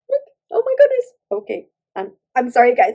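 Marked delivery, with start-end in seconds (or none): 0.1-1.0 high, loud burst of excitement; excited squeal. Real, not acted. Thrilled at her nail, exclaiming to viewers. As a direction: squeal with delight.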